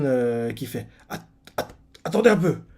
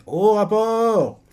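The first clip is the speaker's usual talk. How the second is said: slow, higher announcing call; 8 semitones higher, 4 dB louder, much slower than usual. Retold or imitated. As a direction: call the report out slowly. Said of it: "un autre arrive, fait".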